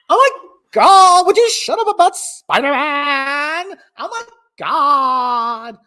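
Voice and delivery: put-on high, exasperated Valley Girl; loud, drawn-out Valley Girl outburst; Valley Girl accent. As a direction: stretch it out like a fed-up Valley Girl. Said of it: "have a Valley Girl accent".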